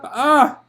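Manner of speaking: loud high pained cry; sharp loud scream; labour scream. Acted. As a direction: scream through the push.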